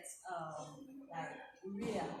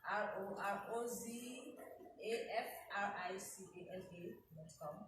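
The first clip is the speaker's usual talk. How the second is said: spelling it out. Shown as a demonstration. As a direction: spell R O R O Z A F R I C A N A letter by letter, unhurried. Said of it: "Could you spell that".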